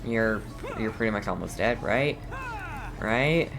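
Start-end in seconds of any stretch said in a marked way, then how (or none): none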